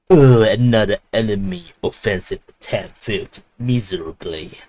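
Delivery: a hint of a sneering British villain; mock the taunt in a posh villain accent; British evil villain accent. Russian ministry taunting Ukrainians, mock British villain accent. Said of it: "not in this accent".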